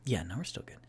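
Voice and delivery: soft whispered aside. Real, not acted. Streamer whispering a quick aside off the game, very soft. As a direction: whisper it off to the side. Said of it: "aside off mic".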